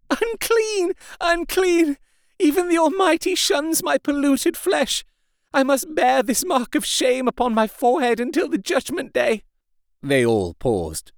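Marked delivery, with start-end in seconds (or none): 0.1-9.4 high anguished wail, much higher. A narrator's reading. Wife wailing her shame before the men, high anguished wail. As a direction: cry out in shame and despair. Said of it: "she wailed out".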